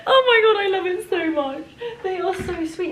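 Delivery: high, excited squeal of joy; squealing delight. Real, not acted. Vlogger squealing with delight over a sausage dog ornament. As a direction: gush over it, laughing.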